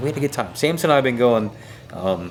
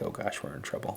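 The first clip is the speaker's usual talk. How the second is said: breathy worried whisper; whispered aside; 4 semitones lower, 14 dB quieter than usual. Retold or imitated. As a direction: whisper it like dread. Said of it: "and I'm like".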